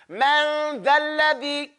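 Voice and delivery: Man dhal ladhi drawn out slowly, mouth wide open; slow teaching demo. Shown as a demonstration. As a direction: exaggerate the mouth for learners. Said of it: "Stick your tongue out".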